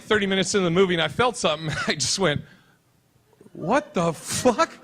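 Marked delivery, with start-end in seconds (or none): 3.5-4.7 slow, drawn-out baffled question; acted stunned confusion; bewildered disbelief. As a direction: stretch each word in mock bewilderment. Acting out bewilderment at own tears.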